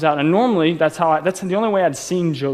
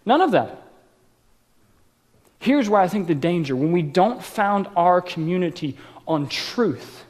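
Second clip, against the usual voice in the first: ordinary talk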